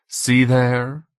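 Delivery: lightly voiced German tormentor; German accent. A narrator's reading. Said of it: "The accent was German".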